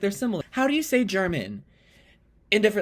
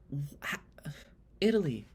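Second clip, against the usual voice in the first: soft, bewildered appeal; hushed pleading; 10 dB quieter, slower than usual. Acted. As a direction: plead quietly with a country as if let down. Acted hurt plea to Italy.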